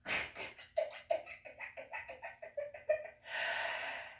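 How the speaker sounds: long breathy high-pitched laugh. Real, not acted. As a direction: let a long laugh trail off. Laughs after the story punchline.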